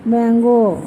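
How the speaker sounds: मँगो said alone with a long falling ending; word modelling. Shown as a demonstration. Mother teaching her child to say मँगो, modelling it. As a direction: stretch out मँगो for a child to copy. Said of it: "म्हण तू मँगो".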